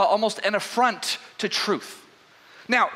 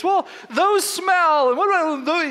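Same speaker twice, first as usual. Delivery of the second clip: higher, louder, indignant servant; livid servant; 10 semitones higher, 4 dB louder than usual. Retold or imitated. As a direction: play the upset servant complaining loudly. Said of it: "she's livid".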